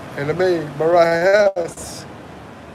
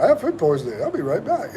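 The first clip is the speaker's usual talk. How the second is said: laughing; 6 semitones higher, 6 dB quieter, faster than usual. Real, not acted. Rereading chat message, amused.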